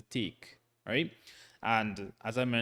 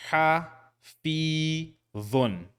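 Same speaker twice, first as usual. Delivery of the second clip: ha, fi, dhun with pauses between; syllable by syllable; 4 semitones higher, much slower than usual. Shown as a demonstration. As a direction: split ha, fi, dhun apart. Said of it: "without pauses this time".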